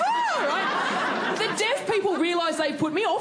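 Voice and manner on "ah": sudden high squeal; high yelp. Retold or imitated. Interpreter copying the comedian's cry, a high yelp. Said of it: "She goes".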